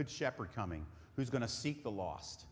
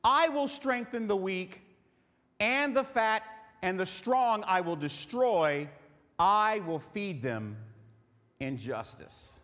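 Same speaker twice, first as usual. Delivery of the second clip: preaching scripture; 5 semitones higher, 5 dB louder, slower than usual. Acted. Preacher reading scripture with force.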